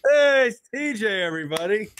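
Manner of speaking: loud high host hello; excited greeting. Real, not acted. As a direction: boom out the welcome.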